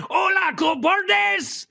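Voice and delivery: put-on laughing Mexican accent shout; mock Mexican accent shout. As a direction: yell it like a cartoon Mexican bandit. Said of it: "talk in a Mexican accent".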